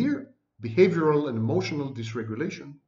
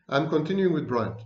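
ordinary talk, nothing marked

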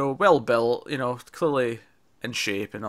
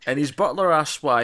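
normal talking voice

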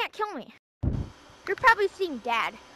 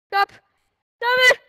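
high, loud yell; shouting; 6 semitones higher, 8 dB louder, much slower than usual. Real, not acted. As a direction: shriek at them to stop. Being chased in game.

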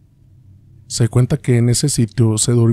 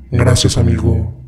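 cavernous voice of the dead; underworld god. Acted. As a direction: speak like a ghost from the grave. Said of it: "con voz cavernosa".

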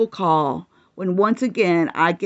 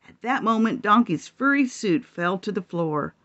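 usual speaking voice

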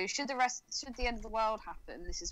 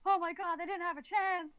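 high-pitched imitation of someone horrified; mock freak-out, rising as it goes; 5 semitones higher than usual. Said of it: "really affected and really upset and horrified".

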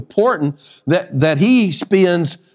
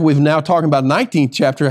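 normal talking voice

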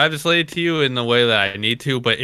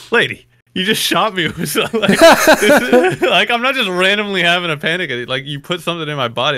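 higher, exasperated protest; indignant retort; 6 semitones higher, 4 dB louder than usual. Acted. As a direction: protest in disbelief, pitch up, breaking into laughter. Acted retort to the nurse.

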